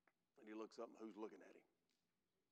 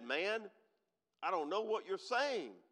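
loud, higher insistence; heated denial; 7 semitones higher, 11 dB louder, slower than usual. Acted. Peter denying to the third accuser, loud and high. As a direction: fling the denial out, raised.

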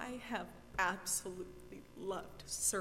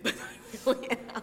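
ugly crying; 2 semitones higher, 10 dB louder than usual. Real, not acted. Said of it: "as I'm ugly crying".

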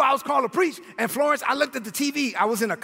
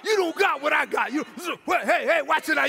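cocky retold taunting; rapid-fire challenge thrown at the screen; mock bragging, pitched up a little; yelling at the TV; 5 semitones higher than usual. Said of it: "I'm yelling at the T V".

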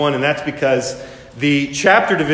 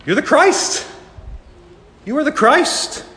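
high, bright burst of conviction; eager exclamation; 9 semitones higher than usual. Retold or imitated. Peter answering Jesus, excited and much higher. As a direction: blurt it out like a sudden revelation. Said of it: "And Peter's like".

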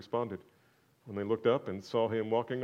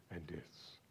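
whispered tag; 6 semitones lower, 18 dB quieter than usual. Acted. Preacher whispering who Jesus is. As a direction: breathe it out unvoiced.

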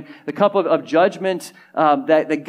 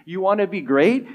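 usual speaking voice

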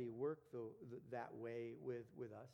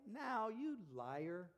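slow, high mock scolding; teasing accusation; 6 semitones higher, 3 dB louder, much slower than usual. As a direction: drag it out, playfully calling them a liar.

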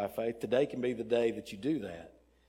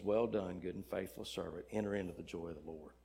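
low, very soft commendation; hushed, lowered voice from the gate; gentle welcome; 7 semitones lower, 11 dB quieter than usual. Acted. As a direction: welcome him in quietly and solemnly. Gate voice welcomes humble man.

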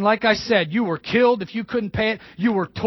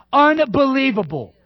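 ordinary talk

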